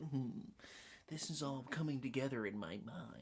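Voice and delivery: soft, pensive mock detective; quiet musing. Acting out the detective pondering in the corner, musing quietly. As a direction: murmur it as if piecing clues together.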